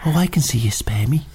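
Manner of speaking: breathy medium summoning spirits; psychic whisper. Acted. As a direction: whisper like a mock clairvoyant. Fake psychic channelling spirits.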